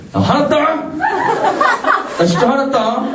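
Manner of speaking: high-pitched, comic indignation; angrier mock warning. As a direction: act out escalating anger, pitch jumping up. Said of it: "좀 더 화를 내죠".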